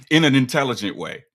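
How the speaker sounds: laughing through the tease. Real, not acted. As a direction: tease him while cracking up. Teasing friend, laughing.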